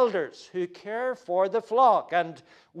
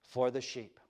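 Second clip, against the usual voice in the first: hushed solemn quote; 6 semitones lower, 7 dB quieter, slower than usual. Shown as a demonstration. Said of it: "Jesus says".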